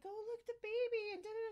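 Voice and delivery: high, loud cooing over pictures; imitated gushing. Friend looking at ultrasound pictures, high imitated gushing.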